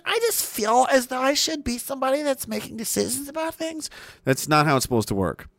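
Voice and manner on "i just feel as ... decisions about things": much higher, softer, fading self-important whine; mock entitled politician. Mocking would-be leaders, high soft and fading. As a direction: parody someone who thinks they deserve power.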